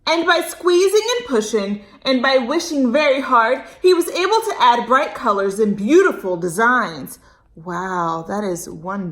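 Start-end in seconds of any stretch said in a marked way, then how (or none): 0.1-7.2 put-on bright, expressive read-aloud for kids; lively storytime narration; storybook reading. A narrator's reading. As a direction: read it like a picture book to a child. Reads story to children.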